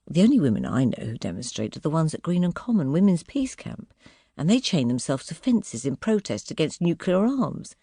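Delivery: ordinary delivery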